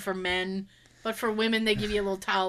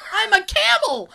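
loud joking squeal; 10 semitones higher, 8 dB louder than usual. Retold or imitated. Joke about not needing to pee.

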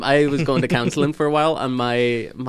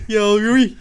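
louder, higher, badly done Dublin; Dublin accent; 7 semitones higher, 7 dB louder than usual. Shown as a demonstration. Repeating the only phrase they can say, bad Dublin accent. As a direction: belt out a clumsy Dublin greeting. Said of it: "that's the only thing I can say".

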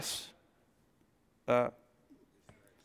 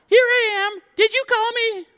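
put-on high little boy voice; a sleepy boy running to Eli; 7 dB louder than usual. Voicing young Samuel.

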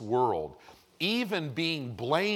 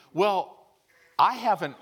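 sudden high exclamation; 7 semitones higher, 6 dB louder than usual. Real, not acted. Admits he hasn't taught it.

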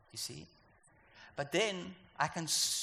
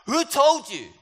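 loud, high calling out; God calling out to Adam and Eve; God shouting; 8 semitones higher, 8 dB louder than usual. Acted. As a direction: shout it from the sidelines. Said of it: "God is shouting from the sides".

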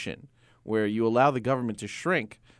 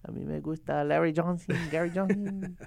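put-on playful Spanish accent, higher; 5 semitones higher, 3 dB quieter than usual. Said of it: "do a Spanish voice".

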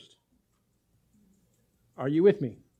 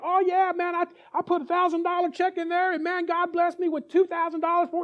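higher, louder imitation of bragging pastors; boasting preacher; 10 semitones higher, 3 dB louder than usual. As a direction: show off the big blessing. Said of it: "They're like".

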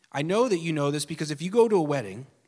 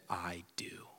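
soft whispered I do; whispered vow; 11 semitones lower, 17 dB quieter than usual. Acted. God vowing to us, whispered. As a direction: breathe the I do. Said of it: "He still said".

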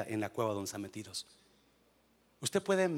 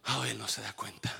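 ordinary talk

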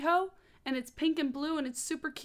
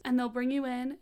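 ordinary talk

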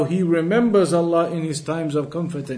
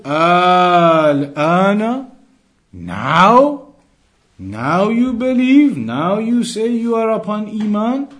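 louder, drawn-out scornful "now?"; incredulous rebuke; 4 semitones higher, 4 dB louder, slower than usual. Acted. Teacher voices God answering drowning Pharaoh, incredulous and louder. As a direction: challenge a too-late believer with disbelief.

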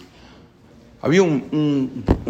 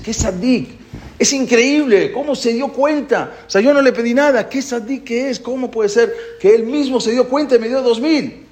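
amazed joy; 10 semitones higher than usual. Retold or imitated. Man amazed at the money.

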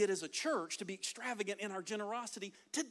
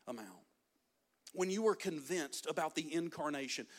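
ordinary delivery

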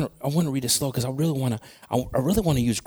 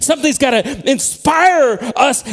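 high, loud, driving sermon voice; impassioned preaching; 10 semitones higher, 6 dB louder than usual. Real, not acted. Preacher urging church to be moved and awakened, impassioned preaching. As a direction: preach it at full force, punching the key verbs.

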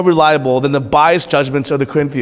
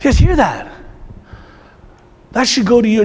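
ordinary talk, nothing marked

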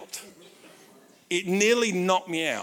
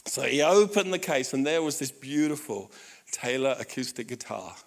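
ordinary talk

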